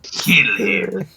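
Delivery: wicked emperor giving an order; Emperor Palpatine. Acted. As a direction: croak it as a sinister command. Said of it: "familiar with the name Emperor Palpatine".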